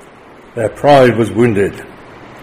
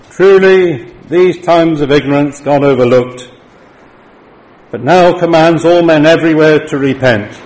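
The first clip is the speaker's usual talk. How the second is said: raised preaching voice for Paul's words; scripture reading; 2 semitones higher, 4 dB louder than usual. Acted. Preacher to congregation, raised proclaiming scripture reading. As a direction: proclaim the apostle's words to the crowd.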